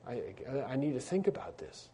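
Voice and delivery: soft, faltering, barely audible; faint voice. Retold or imitated. Overwhelmed Luther asking the court for time, faint voice. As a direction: let the voice nearly vanish. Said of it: "his voice goes away".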